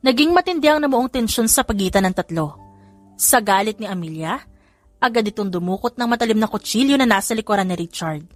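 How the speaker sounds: plain reading voice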